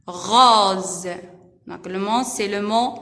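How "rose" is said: "Rose" with the s voiced as z. Shown as a demonstration. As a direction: say "Rose" low and distinct, s sounding as z. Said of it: "S entre deux voyelles se prononce Z".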